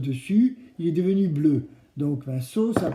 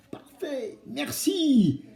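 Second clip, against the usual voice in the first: put-on kids show host cheering; 9 semitones higher, slower than usual. Urging kids to answer louder.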